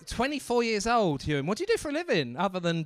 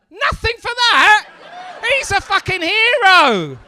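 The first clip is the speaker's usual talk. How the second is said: high, slow, very loud protest; indignant shout; 11 semitones higher, 12 dB louder than usual. Acted. Comedian defending the pub manager to the crowd, indignant shout. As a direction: shout it in mock outrage at the room.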